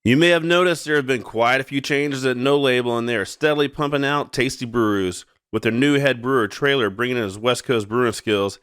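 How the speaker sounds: loud, punchy brewery ad read. Acted. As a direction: sell it like a radio spot. Reads a brewery ad.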